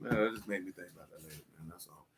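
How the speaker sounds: quiet repeat to himself; muttered aside. Real, not acted. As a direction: mumble it under your breath.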